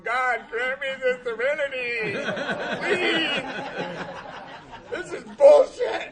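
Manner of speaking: high, loud plea erupting into a yell; desperate shouted prayer. Retold or imitated. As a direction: cry out to heaven in exasperation, drawn out. Acted desperate prayer in jail.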